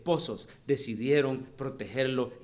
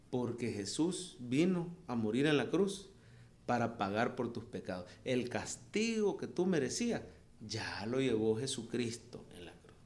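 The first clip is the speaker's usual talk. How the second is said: gentle fatherly explanation; 3 semitones lower, 7 dB quieter than usual. Retold or imitated. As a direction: speak softly to comfort a child. Father gently explains gospel to son.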